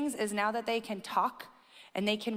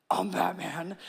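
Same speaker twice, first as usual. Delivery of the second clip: Batman voice; 4 semitones lower, slower than usual. Retold or imitated. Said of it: "in the voice".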